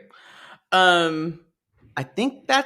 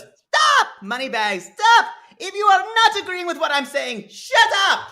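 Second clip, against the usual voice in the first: mock outburst; 13 semitones higher than usual. Telling the mother-in-law to shut up, high mock yelling. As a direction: imitate someone shrieking them into silence. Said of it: "And she goes".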